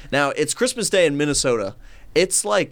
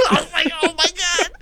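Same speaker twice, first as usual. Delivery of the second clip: high laughter into words; laughing; 13 semitones higher than usual. Real, not acted. Teller laughing as he retells her reaction. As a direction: laugh through the line.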